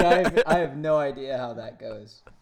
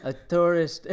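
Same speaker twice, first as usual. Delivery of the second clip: Australian accent attempt, higher and louder, breaking into laughter; 4 semitones higher, 6 dB louder than usual. Reading the kangaroo article to friends in a failing Australian accent. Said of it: "who can do an Australian accent?".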